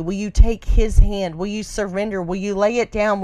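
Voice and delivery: ordinary talk, nothing marked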